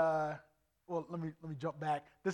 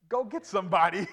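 louder retold demand; insistent demand; 3 semitones higher, 7 dB louder than usual. Preacher replays demanding the guys fetch somebody, loud insistence. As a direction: demand backup, raising your voice.

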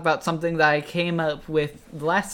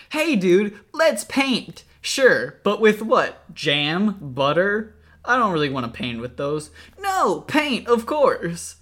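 loud, high cheesy ad voices; hammy retro TV commercial actors; eighties commercial voices; 5 semitones higher, 7 dB louder, slower than usual. Acted. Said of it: "imagine this in, like, an eighties commercial".

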